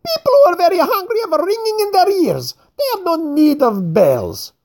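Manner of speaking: mocking fat man. A narrator's reading. Craxy retorts about hunger.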